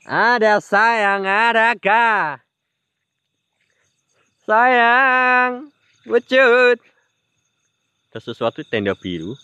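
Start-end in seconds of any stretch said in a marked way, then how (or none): 0.1-6.8 higher, slow summoning croon; coaxing call. Pretend. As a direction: lure the spirit out. Calling spirit to appear. (memanggil hantu dengan merayu)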